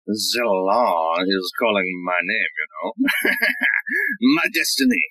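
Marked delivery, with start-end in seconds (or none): 3.0-4.3 self-satisfied laugh. Acted. Arkin boasts of his destiny.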